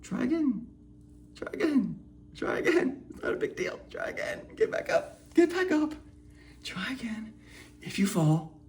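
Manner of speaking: soft, whispered encouragement; gentle coaxing. Acted. An inner coach softly urging a fallen child to get up.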